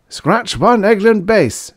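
high, loud, slow joyful cry; joyful yelp. Acted. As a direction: yelp it out in triumph. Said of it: "yelped joyfully".

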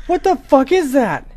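high, loud panicked yell; startled scream. Real, not acted. Startled gamer seeing a monster, screaming. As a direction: shriek at the sudden shape. Sudden scare.

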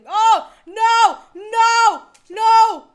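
very loud, high screamed refusals; panicked scream. Real, not acted. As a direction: scream each word in sheer panic. Jump scare in the game.

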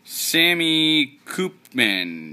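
usual speaking voice

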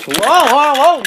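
loud, rising startled whoas. Acted. Toy engine startled by a sudden crash, shouting whoa. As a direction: jump back in alarm.

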